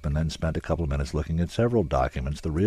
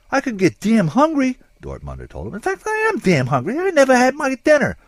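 much higher, louder protest; Dortmunder's voice; 9 dB louder than usual. A narrator's reading. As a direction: whine about missing dinner.

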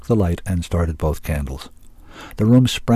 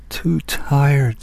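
weary inner thought, pitched up; 7 semitones higher than usual. A narrator's reading. Zack realizes he was too tired, weary. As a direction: think it aloud, worn out. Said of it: "Zack thought wearily".

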